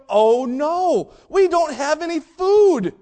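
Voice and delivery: loud, high, drawn-out mock wail; hammed-up complaint, stretched out loudly; mock complaining. Disciples moan on reaching the shore, mock complaining. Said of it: "they start complaining and going".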